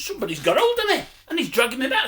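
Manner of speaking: ordinary talk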